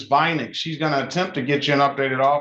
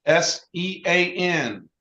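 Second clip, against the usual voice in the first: dictating; slower than usual. Shown as a demonstration. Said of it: "write down your email".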